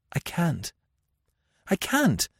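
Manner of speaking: high-pitched, helpless refusal; helpless, pleading repetition. A narrator's reading. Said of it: "she repeated helplessly".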